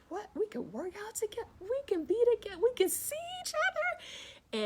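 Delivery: high, loud, incredulous squeals; amazed disbelief. Retold or imitated. Telling her live viewers how she first reacted to Zoom, high incredulous squeal. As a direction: gush in giddy disbelief at the idea.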